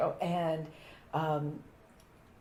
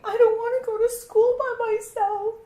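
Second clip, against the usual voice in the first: put-on much higher, whiny little-girl plea; crying child; 5 dB louder than usual. Little girl begging her mother, tearfully whiny. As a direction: beg like a tearful small child. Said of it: "and I'm crying".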